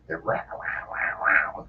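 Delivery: unvoiced rah-rah roar; imitated band vocal. Storyteller mimicking a band's vocalist, imitated rah-rah noise. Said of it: "that was like their".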